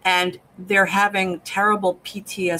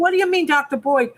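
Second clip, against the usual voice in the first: higher, quick and alarmed; suspicious alarm; 7 semitones higher, faster than usual. Retold or imitated. Henry questioning Victor's slip, higher and alarmed. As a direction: jump on the name in sudden suspicion. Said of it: "Henry got suspicious".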